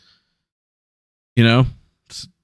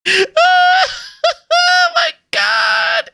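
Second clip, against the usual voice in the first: high, drawn-out laughing; shrieking laughter; much slower than usual. Real, not acted. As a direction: howl with disbelieving laughter. Laughing at the screenshot.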